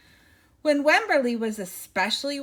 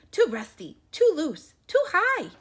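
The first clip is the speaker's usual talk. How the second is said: rising worry; 8 semitones higher, 6 dB louder than usual. A narrator's reading.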